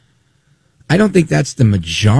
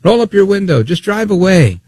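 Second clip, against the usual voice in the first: raised, higher imitation of a man yelling; higher, pushy mock yell; 9 semitones higher than usual. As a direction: bark it like the heckler at the cops. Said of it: "And he's yelling at them".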